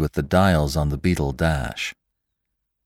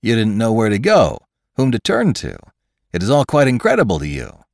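higher, eager sympathy; grinning cry; 6 semitones higher, 5 dB louder, faster than usual. A narrator's reading. Scientist explains Lantry's bewilderment for him, crying out with a grin. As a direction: say it brightly through a grin. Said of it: "cried McClure, grinning".

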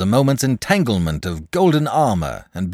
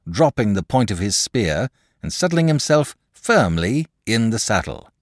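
plain reading voice

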